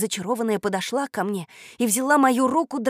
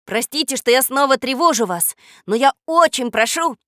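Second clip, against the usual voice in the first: earnest, higher, louder entreaty; solemn appeal; 4 semitones higher, 6 dB louder than usual. A narrator's reading. Boy begs the textbook for help, solemn pleading. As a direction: appeal with ceremonious urgency. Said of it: "торжественно начал я".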